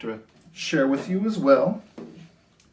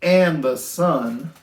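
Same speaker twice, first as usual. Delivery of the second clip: sing-song rhyming storybook read; lilting picture-book voice; reading to children; 11 dB louder, slower than usual. A narrator's reading. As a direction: read it gently to a class of little kids.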